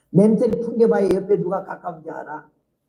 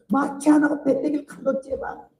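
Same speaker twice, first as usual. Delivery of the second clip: normal talking voice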